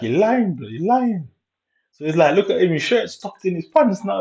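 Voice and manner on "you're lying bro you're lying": imitating a friend calling him a liar, pitched high; retold accusation, voice jumps up; pitched-up mimic of a mate shouting liar; mock accusing friend.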